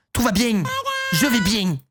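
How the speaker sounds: hammed-up Marseille twang on "bien"; exaggerated Marseille accent. Sister insisting she is fine, exaggerated Marseille accent. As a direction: overdo the Marseille accent on each word ending. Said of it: "Tellement exagéré".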